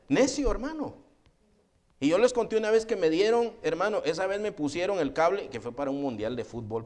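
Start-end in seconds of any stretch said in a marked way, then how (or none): 0.0-1.0 laughing. Real, not acted. Laughing about the stubborn salesman.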